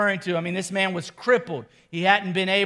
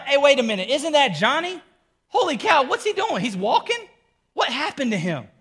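high, amazed disbelief; stunned bystander crying out, much higher; astonished crowd; 7 semitones higher than usual. Acted. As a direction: gasp it out like you can't believe your eyes. Crowd shocked seeing Johnny walk.

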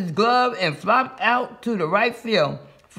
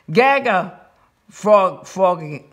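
plain reading voice

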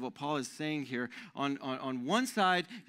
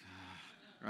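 soft, much quieter mock sigh of annoyance; imitated exasperated sigh; 10 semitones lower, 19 dB quieter than usual. Pastor imitating his wife's sigh when he does something stupid. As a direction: breathe out a tired "ah" like a fed-up wife. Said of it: "my wife sighs. She's like".

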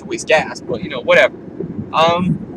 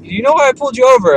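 cop; 9 semitones higher, 7 dB louder, faster than usual. Retold or imitated. Cop voiced in retold traffic stop.

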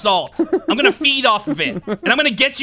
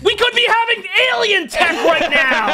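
mock angry shout; 9 semitones higher than usual. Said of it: "I don't care about this".

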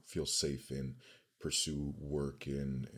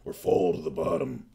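building hype, louder toward the end; announcer voice; 6 semitones higher, 10 dB louder than usual. Acted. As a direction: sell it like a trailer voiceover. Hypes the channel point redeems.

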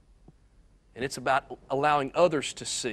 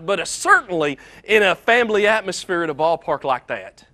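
louder and higher, building urgency as the dad panics; rising alarm; 5 semitones higher, 7 dB louder than usual. A narrator's reading. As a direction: tell it faster and louder as the panic grows. Dad panics and curses in story.

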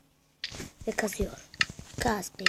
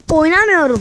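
furious shout; 6 semitones higher, 12 dB louder than usual. Real, not acted. Gamer quitting after dying, furious shout. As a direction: yell it out, fed up for real.

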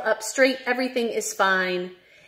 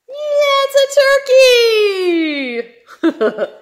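much higher, louder cry of delight; excited reveal; 8 dB louder, much slower than usual. Acted. Teacher revealing the answer to kids, excited cry. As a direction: build to the big reveal with glee.

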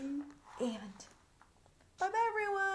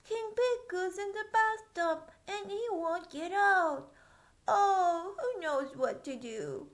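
panicked page; 6 semitones higher, 8 dB louder than usual. Acted. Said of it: "cried the page".

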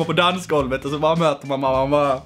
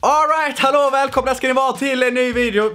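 pumped-up opening greeting; hyped intro; 8 semitones higher than usual. Acted. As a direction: burst in like a host starting the show.

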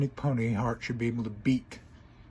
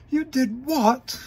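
high, loud, slow "You did what?"; shocked outburst; 13 semitones higher, 7 dB louder, slower than usual. Acted. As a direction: blurt it in disbelief. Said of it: "Sounded like Ron Weasley".

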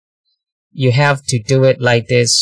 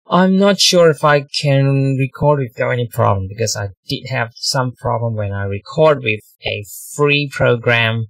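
ordinary talk

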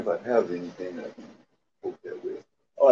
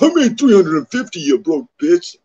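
mocking Landon; 8 semitones higher, 8 dB louder than usual. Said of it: "Landon's like".

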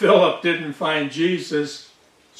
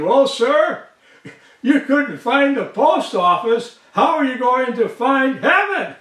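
higher-pitched retold little boy's comeback; lightly acted cheeky kid, pitched up; cheeky punchline; 6 semitones higher than usual. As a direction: deliver the boy's challenge as a joke's payoff.

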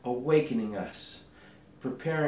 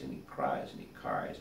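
dropping to a whisper and much lower; fading away; 7 dB quieter than usual. A narrator's reading. Prince weeping alone outside the gate, fading to a whisper. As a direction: let it fade to a whisper.

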